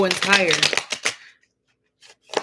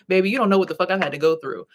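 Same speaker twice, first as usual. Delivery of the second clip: ordinary talk, nothing marked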